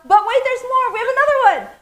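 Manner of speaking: very high, loud squeal of excitement; excited surprise. Real, not acted. Host announcing yet another giveaway, excited. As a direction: burst in high and loud, thrilled.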